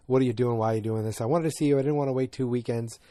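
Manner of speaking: usual speaking voice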